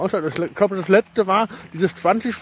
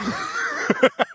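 high breathy laugh. Real, not acted. Laughs at own Latin slip.

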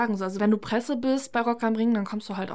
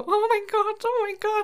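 fangirl squeal; 12 semitones higher, 4 dB louder than usual. Retold or imitated. Squealing about seeing [name] from Kraftklub backstage. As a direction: squeal it like a starstruck fan. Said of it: "komplett into it".

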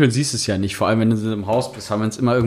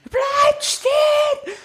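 very high, slow drawn-out yell; crazed shout; much slower than usual. Retold or imitated. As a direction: scream it like a madwoman. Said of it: "wie eine Geisteskranke".

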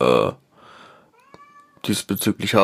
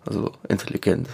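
sarcastic; 5 dB quieter, faster than usual. Real, not acted. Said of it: "in Anführungszeichen".

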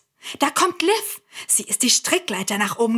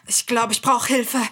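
normal talking voice

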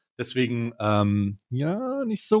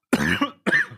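choking cough after swallowing wrong; harsh coughing fit; 8 semitones lower than usual. Real, not acted. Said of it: "ich hab mich verschluckt".

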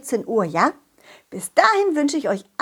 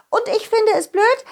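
put-on high fairy child voice; 10 semitones higher, 3 dB louder than usual. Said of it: "Und dann legte sie los".

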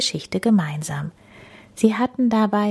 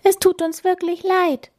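high, small mouse voice; apologetic mouse; 12 semitones higher than usual. A narrator's reading. Mouse apologising at the door, high squeak. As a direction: plead sorry in a squeak. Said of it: "sagte die Maus noch einmal".

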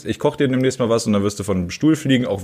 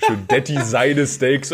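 laughing; 4 semitones higher, slower than usual. Real, not acted. Laughing mid-argument.

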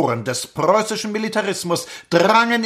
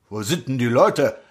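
clipped nasal officer; 6 semitones lower than usual. Acted. Lieutenant enters the room.

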